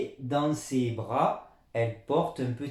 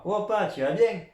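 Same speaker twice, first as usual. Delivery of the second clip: put-on high, louder child-to-father greeting; acted greeting to dad; 8 semitones higher, 4 dB louder than usual. Teacher acts a greeting to dad, pitched up. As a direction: call out a casual question to dad, pitched up. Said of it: "qui veut déjà dire".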